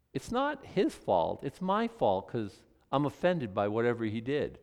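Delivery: usual speaking voice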